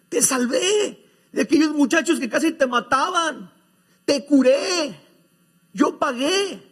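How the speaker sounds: a father amazed, pleading with his worried adopted son; astonished father. Acted.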